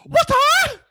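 sudden high shrill martial-arts yelp, loud; Bruce Lee impression. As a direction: do a quick Bruce Lee cry. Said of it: "He did the Bruce Lee impression".

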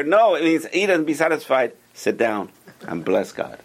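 usual speaking voice

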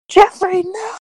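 high-pitched cry of dismay; alarmed kobold. Acted. Kobold calling out to his companion, alarmed. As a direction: cry out in a squeaky, hammed-up panic.

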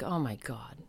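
stunned thought, voiced softly; hushed stunned aside. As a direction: say it under your breath, retelling your shock. Retold stunned thought, pitying him.

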